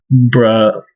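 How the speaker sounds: ordinary delivery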